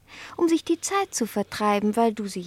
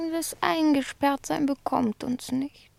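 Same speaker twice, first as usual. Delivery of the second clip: ordinary delivery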